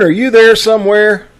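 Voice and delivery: normal talking voice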